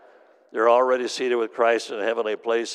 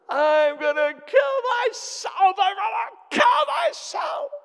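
full-out blood-curdling scream, very high; Marge; 5 dB louder, slower than usual. Retold or imitated. As Marge screaming in the church, blood-curdling shriek. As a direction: shriek it slowly, drawing out every word. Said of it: "she was screaming bloody murder".

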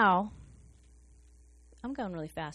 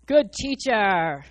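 drawn-out, louder fawning "Good teacher"; flattering; 6 dB louder, much slower than usual. Retold or imitated. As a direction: lay it on thick, "Good teacher" stretched slow. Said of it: "how he comes off to Jesus".

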